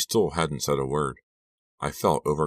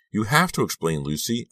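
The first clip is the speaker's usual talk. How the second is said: higher, shaky pleading; trembling voice; 7 semitones higher than usual. A narrator's reading. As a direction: voice trembling with emotion. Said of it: "I repeated in a trembling voice".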